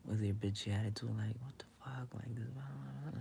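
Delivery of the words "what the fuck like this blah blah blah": whispered, much softer mock indignation; mock muttered curse. The one left behind in an argument, whispered mock indignation. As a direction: mutter it under your breath in mock outrage. Voicing the angry other person.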